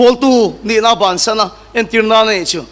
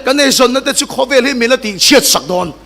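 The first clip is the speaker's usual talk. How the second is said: normal talking voice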